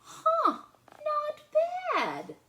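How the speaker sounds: very high loud huh, then not bad; pleasantly surprised. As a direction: taste it and be surprised. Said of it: "said Lola".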